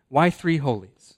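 ordinary delivery

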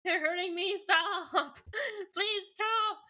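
high, whimpering hammed-up begging; mock pained pleading. Cassie hurt by the voice in her head, mock anguished pleading. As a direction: plead in exaggerated pain. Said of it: "like, hurting Cassie".

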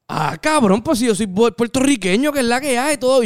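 high, excited stranger greeting a fellow Puerto Rican. Retold or imitated. Stranger learns the speaker is boricua, high and thrilled. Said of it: "como un mexicano que estaba como que imitando el acento puertorriqueño".

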